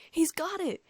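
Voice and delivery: high, loud cry of joy; delighted scream. A narrator's reading. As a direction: cry out, thrilled at the catch. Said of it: "I screamed with delight".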